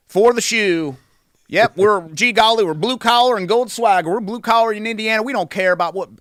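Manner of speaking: mock a gee-golly Indiana country accent; folksy country accent. Host mocking sports marketing aimed at small-town Indiana fans, hammed-up country accent. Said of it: "our friends that even have that accent".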